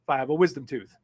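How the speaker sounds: usual speaking voice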